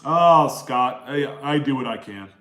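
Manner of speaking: ordinary delivery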